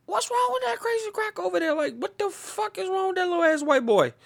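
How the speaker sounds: high put-on gossip voice; mock onlooker. Neighbours gossiping about young Michael, high scandalised voice. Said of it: "So it was like".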